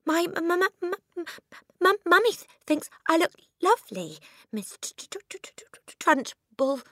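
very high stammer, fading to a breathy whisper on the name; terrified stutter. Acted. Frightened girl stammers a reply to the headmistress. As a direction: stammer helplessly, losing breath on Miss T-. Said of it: "Amanda stuttered".

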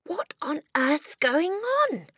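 put-on high, drawn-out child's question; alarmed cry. A narrator's reading. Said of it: "she cried".

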